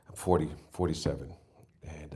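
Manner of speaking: normal talking voice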